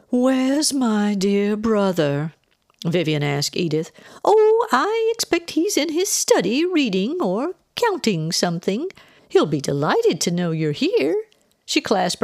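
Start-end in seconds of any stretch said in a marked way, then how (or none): 0.1-2.3 slow, higher, drawn-out question; grand socialite. A narrator's reading. Visitor asking after her brother, grand drawl. 4.2-11.2 high, swooping, chirpy chatter; fluttery aunt. A narrator's reading. Aunt assuring the guest of her welcome, fluttery.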